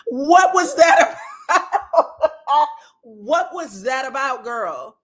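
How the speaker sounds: high-pitched question breaking into real laughter; laughing disbelief. Real, not acted. Vlogger asking about the yellow brick road jab, laughing in disbelief. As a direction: crack up while asking it.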